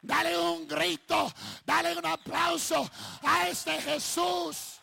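high, loud, stretched-out rallying shout; full-force preaching. Real, not acted. Preacher calling for applause for Jesus, full-force shout. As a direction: rouse the crowd at full voice.

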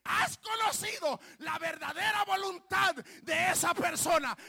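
shouted, rising pulpit preaching; fiery preaching. Acted. Preacher shouting at the congregation about a stubborn person.

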